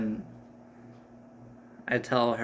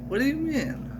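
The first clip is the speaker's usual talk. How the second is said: high, indignant squeak; high-pitched offended question; indignant what; 14 semitones higher than usual. Acted. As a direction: protest in mock outrage. Indignant protest among friends.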